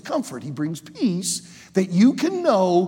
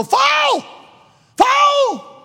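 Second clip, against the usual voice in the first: high, loud referee shout; referee yelling foul; 7 dB louder than usual. Retold or imitated. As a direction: yell it like a ref blowing the whistle.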